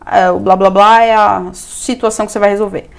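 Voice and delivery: usual speaking voice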